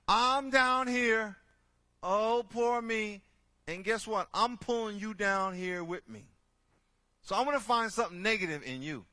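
mock insecure critic.

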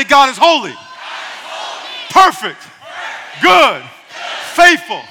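shouted praise. Real, not acted. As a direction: shout each word full voice. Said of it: "Shout it".

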